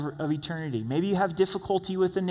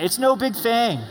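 said like you mean it; 7 semitones higher, 5 dB louder than usual. Shown as a demonstration. Pastor leading congregation in a bold declaration, loud. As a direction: declare it with full conviction. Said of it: "Say it like you mean it".

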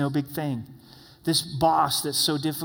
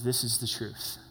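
much softer, lower, calm; quiet acceptance; 5 semitones lower, 7 dB quieter than usual. Retold or imitated. David humbly conceding to Abigail, soft and low. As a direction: let it land quietly, humbled. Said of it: "He just stops. He listens".

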